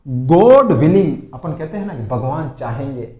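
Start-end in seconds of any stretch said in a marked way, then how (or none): 0.1-1.2 very slow and loud; slow teacher demo; slow model phrase. Shown as a demonstration. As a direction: say it slowly for the class. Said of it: "second देखो".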